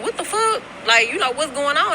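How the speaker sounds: a sudden high "what the fuck", caught off guard; shocked outcry. Retold or imitated. Woman hearing her ex honk outside, shocked outcry. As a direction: jump way up high, startled and alarmed. Girlfriend startled by horn blasting.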